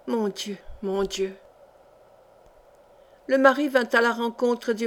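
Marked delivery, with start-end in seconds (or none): 0.1-1.3 quietly lament, as if to yourself; subdued lament. A narrator's reading. Sick traveller left alone with her thoughts, softly lamenting.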